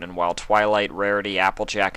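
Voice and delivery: plain reading voice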